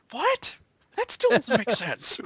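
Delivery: overplayed shock at nonsense; mock outraged disbelief.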